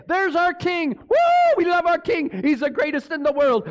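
high, excited crowd cheer with a whoop; cheering townspeople. Acted. Town welcoming their king back from war, cheering. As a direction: hail the king like an adoring crowd. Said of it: "what is the whole town saying?".

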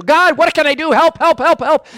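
high, loud panicked cry for help; hammed-up desperate prayer; frantic prayer. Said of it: "He is freaking out".

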